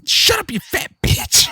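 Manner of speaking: very high, slow, loud sneering insult; mocking dad. The dad insulting his son, loud sneering voice. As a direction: spit it out like a cruel dad. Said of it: "he's like".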